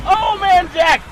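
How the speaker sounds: loud, high, drawn-out proclamation; shouted tribute. Real, not acted. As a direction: shout it slowly like a stadium tribute.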